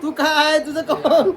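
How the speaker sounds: high, loud laughing teasing; laughing tease. Real, not acted. Teasing crying child, laughing.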